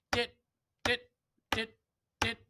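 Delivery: "dit" very short with a rest after each; staccato. Shown as a demonstration. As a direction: make each "dit" a tiny note followed by silence. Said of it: "an eighth note followed by a rest".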